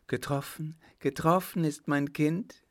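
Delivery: high, rising cry of a frightened mother; horrified question. A narrator's reading. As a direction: echo the word in dawning horror. Mother learns her child was shot. (entsetzt nachgefragt)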